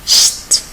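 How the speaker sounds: "St" breathed out without voice, as sht; voiceless St sound. Shown as a demonstration. Teacher shows the German St cluster alone, breathed. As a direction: isolate "St" unvoiced, like sht. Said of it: "S T".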